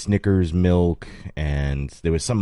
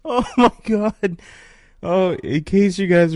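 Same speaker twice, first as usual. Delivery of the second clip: laughing; 12 semitones higher, 4 dB louder, slower than usual. Real, not acted.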